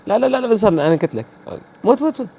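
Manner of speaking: screaming die at the enemy; frantic outburst. Real, not acted. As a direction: lose it in the heat of the fight. Frantic outburst during firefight.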